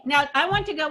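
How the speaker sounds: ordinary talk, nothing marked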